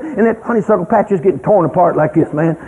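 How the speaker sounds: ordinary delivery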